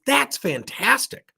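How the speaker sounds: high, drawn-out mock enthusiasm; upbeat. Manager cheering on his supervisor ambition, upbeat. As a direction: gush slowly with exaggerated delight. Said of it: "you gotta be upbeat".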